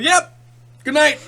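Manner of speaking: high, loud, clipped farewell; loud curt goodbye. Retold or imitated. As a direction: bark a quick goodbye. Mock curt goodbye, retold.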